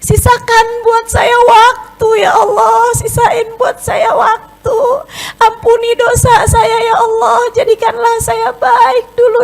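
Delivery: very high, loud sobbing cries to God; fearful crying prayer. Acted. Preacher acting a sinner crying out to God in fear. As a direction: wail for mercy, terrified of dying in sin. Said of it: "menangis karena takut".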